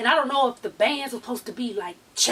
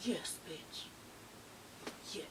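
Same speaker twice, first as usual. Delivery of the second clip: voiceless whisper, much softer; proud whisper; 7 semitones lower, 22 dB quieter, slower than usual. Retold or imitated. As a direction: breathe it out in awe.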